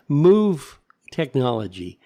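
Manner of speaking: usual speaking voice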